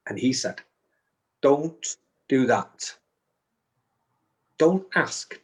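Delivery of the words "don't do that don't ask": slow, deliberate telling-off; measured, emphatic warning; stern reprimand. Retold or imitated. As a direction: correct a pupil firmly, word by word. Retold reprimand from the medium.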